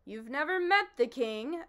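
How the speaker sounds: angry accusation. Acted. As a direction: call out the liar as the bee. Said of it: "accused the bee angrily".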